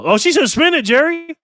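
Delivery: high, loud, excited relay; excited exclamation. Real, not acted. Excited relay of player's call.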